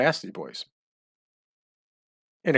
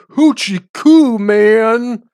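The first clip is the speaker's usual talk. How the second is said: put-on high, drawn-out drunken holler back; imitated yell; 9 semitones higher, 7 dB louder, much slower than usual. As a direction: yell it back long and loud across the bar. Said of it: "he would yell out".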